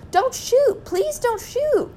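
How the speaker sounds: very high, desperate begging; panicked pleading cry. A narrator's reading. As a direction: beg for your life, voice shooting up and drawn out. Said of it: "called out Mister and Missus Gregg".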